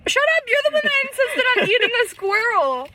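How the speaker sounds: high, squealing "shut up"; teasing high-pitched protest; playful outburst. Real, not acted. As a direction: fake outrage at a friend's joke. Playful outrage at friend.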